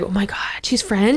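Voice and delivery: hushed whisper of amazement; awed whisper. Retold or imitated. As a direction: whisper it like a secret. Classmates gossip about her.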